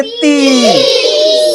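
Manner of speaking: ती with a long, held "ee"; ती, stretched out for the class to copy; drawn-out vowel. Shown as a demonstration. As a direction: hold the vowel of ती. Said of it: "थोड़ा खींच कर बोलेंगे".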